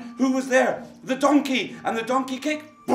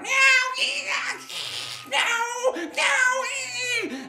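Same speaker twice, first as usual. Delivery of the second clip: cat meowing; much slower than usual. Acted. The cat joins the noise to scare off the thieves with yowling. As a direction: yowl like a cat in the night. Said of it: "And the cat went".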